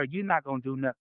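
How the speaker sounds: normal talking voice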